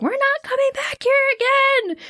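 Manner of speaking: high, strained battle cry; fierce vow. Retold or imitated. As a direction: spit it out mid-struggle. Travis killing Ross.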